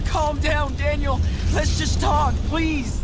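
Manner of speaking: high, loud, urgent plea; desperate pleading. Acted. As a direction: beg him over the uproar. Brother pleads over the uproar.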